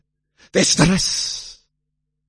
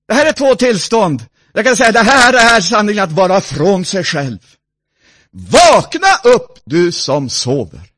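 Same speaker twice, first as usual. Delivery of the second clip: louder, faster fervent preaching; 6 semitones higher, 8 dB louder than usual. Acted. Preacher urging sleepers to wake up. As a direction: press the truth home with force.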